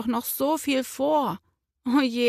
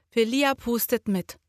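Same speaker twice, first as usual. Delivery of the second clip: ordinary delivery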